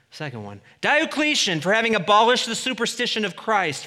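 loud, high, grand inscription read; mock imperial proclamation. Preacher reading Diocletian's monument as a pompous proclamation. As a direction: announce it like a boastful emperor.

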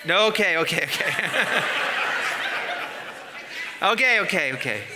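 real laughter breaking up the words; laughing. Real, not acted. Preacher reacting to the congregation over vomit talk, laughing.

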